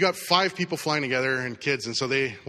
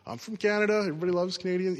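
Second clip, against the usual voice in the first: friendly small talk; 5 semitones higher than usual. Retold or imitated. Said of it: "So I start just, ... chatting".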